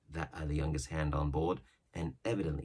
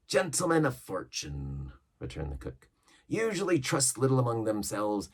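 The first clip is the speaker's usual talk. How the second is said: lightly voiced old pirate, a touch higher and louder; Long John Silver; 4 semitones higher, 7 dB louder than usual. Silver yarning to the crew about old ships, mild pirate voice. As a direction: spin the yarn like an old sea cook.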